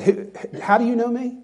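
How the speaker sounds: suspicious question, pitched high; cynical. Acted. Nathanael answering the Lord, cynical. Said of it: "Nathanael responded cynically".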